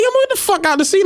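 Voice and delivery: high sharp order; mock yell. Driver ordering kid out of seat, high mock yell. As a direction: bark it like a bus driver. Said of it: "I come up like".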